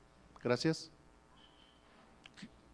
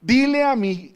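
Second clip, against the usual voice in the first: loud, raised proclamation; Jesus commanding; 7 semitones higher, 7 dB louder, slower than usual. Retold or imitated. Preacher voices the Lord ordering his people, loud command. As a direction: launch a divine order at full voice. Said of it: "el Señor Jesús le dice".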